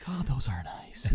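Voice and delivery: unvoiced-sounding mock awe in a whisper; breathy comic aside; hushed admiration. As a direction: whisper it appreciatively, as if ogling. Acting out ogling the princess.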